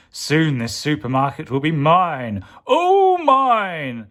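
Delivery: rising to a high, loud "all mine"; gloat, climbing to a squeal; gloating villain. Acted.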